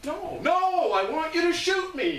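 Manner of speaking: high, loud frantic insistence; screaming man. Retold or imitated. Man insisting to the food volunteer, screaming. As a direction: yell the refusal high and full out. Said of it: "this guy's screaming".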